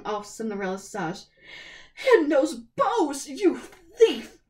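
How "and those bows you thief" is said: loud, slow furious yell; angry accusation. A narrator's reading. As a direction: spit out thief. Stepsisters tearing her dress.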